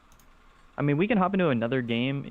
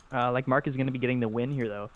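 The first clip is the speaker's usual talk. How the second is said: normal talking voice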